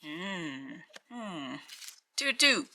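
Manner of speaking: usual speaking voice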